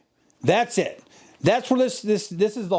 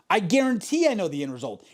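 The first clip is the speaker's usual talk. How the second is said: ordinary talk, nothing marked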